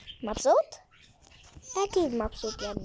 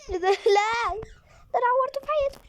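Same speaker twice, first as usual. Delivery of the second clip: dismay; 11 semitones higher, 4 dB louder than usual. Real, not acted. Dismayed after hurting baby.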